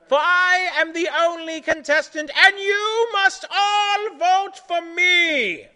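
high, very loud, drawn-out proclamation; full-volume demanding yell. Acted. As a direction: bellow it high like a megalomaniac contestant. Centipede yells at the judge.

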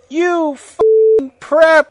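slow, high, loud mock fury; angry yell, hammed up. A narrator's reading. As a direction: stretch it into an overacted angry shout. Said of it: "we all yielded angrily".

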